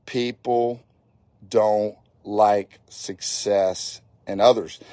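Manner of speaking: slow and measured, word by word; real slow. Shown as a demonstration. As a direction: spell it out slowly with deliberate breaks. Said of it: "I'm gonna say that again real slow".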